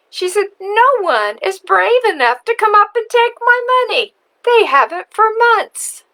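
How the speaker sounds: put-on high, loud cackling old witch; mock a child asking for money. The witch telling the paper girl she never pays, high and mocking.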